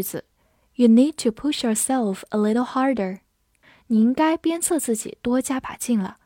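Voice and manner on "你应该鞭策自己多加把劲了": normal talking voice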